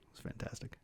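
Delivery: breathy whispered aside. Real, not acted. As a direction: whisper it like a secret. Whispered aside about Target date.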